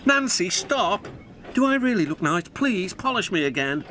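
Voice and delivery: pleading engine voice. Acted. Skarloey pleads with Nancy.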